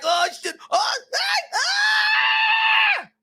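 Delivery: put-on high, crying shout of a hurt kid; mock crying yell, pitched high; panicked crying yell. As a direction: yelp in fright like a six-year-old who just crashed. Retelling falling off his bike.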